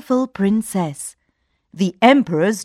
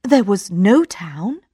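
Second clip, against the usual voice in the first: usual speaking voice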